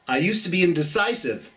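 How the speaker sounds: ordinary delivery, nothing marked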